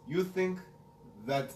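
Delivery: imitating Khabib's Russian accent; broken English, Russian accent. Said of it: "I like your Russian accent".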